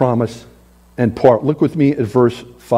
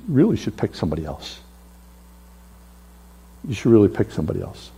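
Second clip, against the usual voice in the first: meek plea; 7 semitones lower, 7 dB quieter, much faster than usual. Acted. Moses begs God to pick another.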